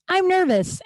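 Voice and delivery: high, blurted "I'm nervous"; blurted confession. Retold or imitated.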